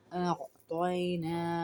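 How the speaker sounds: normal talking voice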